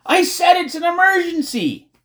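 very high, loud outburst; exasperated shout. A narrator's reading. Irritated girl interrupting a rambling friend, exasperated shout. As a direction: shout over someone who will not listen.

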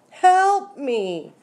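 high, loud cry for help; screamed. A narrator's reading. Rita with a squirrel in her hair, screaming. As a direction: scream for rescue at a high pitch. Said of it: "screamed Rita".

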